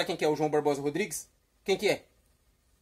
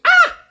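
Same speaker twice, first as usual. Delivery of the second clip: sudden high, very loud "ah" shout; demonstrated yell; 17 dB louder than usual. Shown as a demonstration. Host shows Carlos how to yell, loud shout. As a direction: let out one sharp yell.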